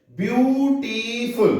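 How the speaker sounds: Beautiful drawn out slowly, each of its three sounds spread out; slow syllable demo. Shown as a demonstration. Teacher showing students the syllables of beautiful, said slowly. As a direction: stretch beautiful slowly so the syllables can be heard. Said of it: "ये तीन word आपके हो रहे हैं sound".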